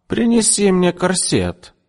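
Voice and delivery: high, slow imperious order; bossy demand. A narrator's reading. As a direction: order the shopgirl around. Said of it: "скомандовала она". (высокий, медленный властный приказ)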